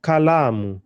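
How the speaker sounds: Kalamu with a punched, lengthened la; Kalamu, weight on la; stress on LA. Shown as a demonstration. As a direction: hit the middle syllable la in Kalamu. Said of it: "my emphasis is on the la".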